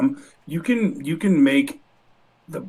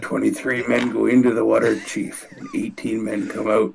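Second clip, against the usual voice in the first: a lightly voiced, salty old sea captain telling his tale; quoting the Indianapolis speech with a hint of Quint; Quint from Jaws; 2 semitones lower than usual. Co-host quotes Jaws to the streamer, lightly doing Quint. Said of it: "you kinda sound like Quint from Jaws".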